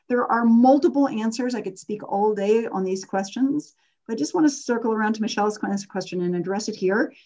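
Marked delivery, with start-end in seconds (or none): none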